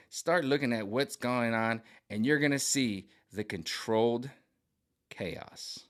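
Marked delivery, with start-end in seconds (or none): 5.1-5.8 whispered chaos. Real, not acted. Stressing controlled chaos.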